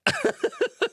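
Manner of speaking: high, rapid real laugh; high giggle. Real, not acted. Streamer finds angry comment so funny, high giggle.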